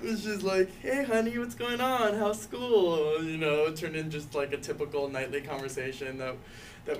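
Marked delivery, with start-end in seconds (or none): 0.8-3.2 high, cheery mom voice; cheerful mom. Retold or imitated. Son imitating mom's cheery phone greeting, higher. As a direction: play the bright, chirpy mother.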